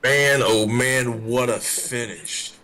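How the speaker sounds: loud, slow and drawn out; amazed. Real, not acted. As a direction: savor the finish, booming. Reacting to the photo finish.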